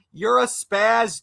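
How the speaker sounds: ordinary delivery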